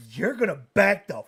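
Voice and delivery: high, very loud imitation of an angry daughter; mock furious shout. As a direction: belt it out as the daughter charging in. Daughter bursts into the retold fight.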